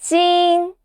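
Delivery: "Jingtian." split into two high, level syllables; evenly pitched high "Jingtian."; first tone. Shown as a demonstration. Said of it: "That's the first tone".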